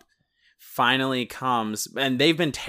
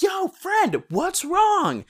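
much higher, bright call into the house; 3 dB louder, much slower than usual. Pretend. Friend entering the house, calling out openly. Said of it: "I would have been like".

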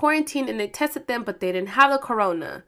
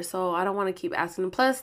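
usual speaking voice

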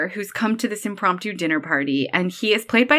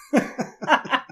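high burst of laughter; 6 semitones higher than usual. Real, not acted. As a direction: laugh out hard at the joke. Laughing at a funny line.

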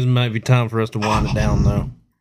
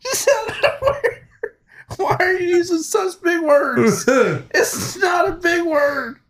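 high squeaky mock-crying shout; hammed-up high mock sob; mock outrage; slower than usual. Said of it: "mocking the complaint".

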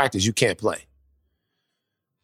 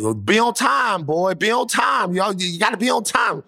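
scolding dad; 9 semitones higher than usual. Retold or imitated. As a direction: stretch each word in an exasperated scolding. Dad scolds son for lateness.

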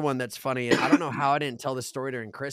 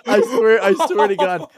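ordinary delivery

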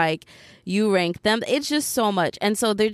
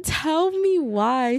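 1 drawn out; 7 semitones higher, much slower than usual. Real, not acted. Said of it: "narrator's dramatic aside".

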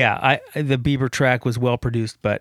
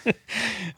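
hysterical laugh; 8 semitones higher, 7 dB quieter than usual. Real, not acted. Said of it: "why are you laughing so hard about that?".

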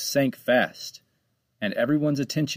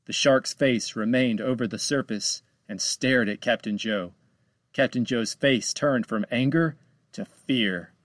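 ordinary delivery, nothing marked

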